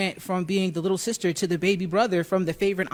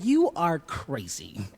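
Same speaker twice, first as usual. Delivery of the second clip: slow, drawn-out mock disbelief; acted-out incredulous verdict, stretched slow; disbelieving scolding; 3 semitones lower, much slower than usual. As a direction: draw out each word like a stunned girlfriend. Girlfriend's stunned scolding, acted.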